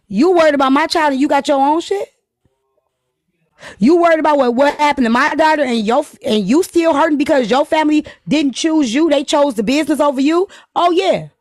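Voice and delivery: rising, sharp confrontational comeback; heated retort. Retold or imitated. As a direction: throw her words back at her heatedly. Angry mocking quote of a fight.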